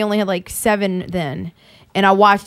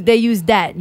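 ordinary talk, nothing marked

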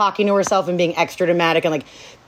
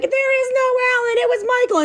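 lightly voiced girl, much higher; high mock Trisha; faster than usual. To Dawn, confessing Michael is the father, high mock voice. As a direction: squeak out the confession in a high girlish voice.

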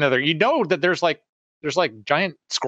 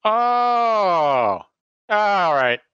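a sudden gleeful shout; thrilled yelp; excited outburst; 6 semitones higher, much slower than usual. Real, not acted. Delighted outburst.

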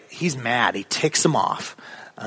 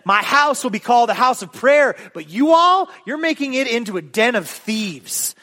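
raised, higher voice of an indignant Jesus; an acted scolding, mildly heated; mild angry rebuke; 6 semitones higher than usual. Jesus to the temple sellers, indignant rebuke.

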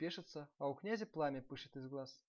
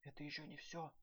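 hushed, softer servant voice; quiet; 7 dB quieter than usual. A narrator's reading. Third servant warning his fellows, quietly. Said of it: "тихо сказал третий".

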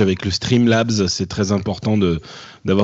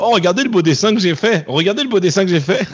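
high, showing-off mock voice; sing-song brag about a drawing; mocking a bragging friend; 8 semitones higher than usual. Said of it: "Valentin screenshotait tous ces trucs pour dire".

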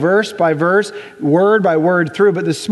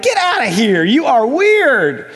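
mock-appalled brush-off with a stretched last word; acted dismissive boss; 4 semitones higher, slower than usual. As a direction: send them away, drawing out the verdict. Boss acted, rejecting the prayerful employee.

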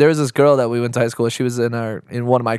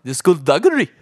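higher, laughing try at Scottish; attempted Scottish accent; 4 semitones higher than usual. Co-host who can't do accents, trying Scottish. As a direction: have a giggly go at a Scottish accent. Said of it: "Do you wanna do a Scottish accent".

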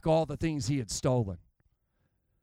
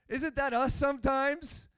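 ordinary talk

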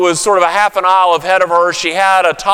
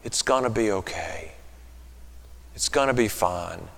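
put-on much softer and lower, soothing a toddler; gentle whisper; 9 semitones lower, 14 dB quieter than usual. Shown as a demonstration. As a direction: hush them close to the ear, very softly. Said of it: "whisper gently in their ear".

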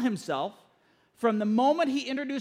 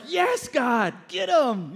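eager, raised-voice egging on; cheering on; 3 semitones higher, 3 dB louder, much slower than usual. Acted. Fed-up listener urging God to punish complainers, cheering. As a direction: urge God on like a rowdy supporter.

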